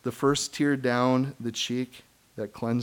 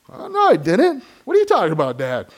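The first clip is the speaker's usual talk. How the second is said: lightly voiced child's denial, higher; imitating his kid; 7 semitones higher, 7 dB louder, faster than usual. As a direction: play the kid caught out. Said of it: "learning a lot from my own kids".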